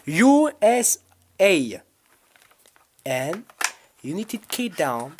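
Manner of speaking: slow, drawn-out mock English accent on U S A. Greeting American and British viewers in a mock English accent. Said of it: "vous aurez bien écouté mon accent anglais".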